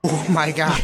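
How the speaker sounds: breathless after climbing stairs; panting between words; out of breath. Real, not acted. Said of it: "every one of these videos I'm out of breath".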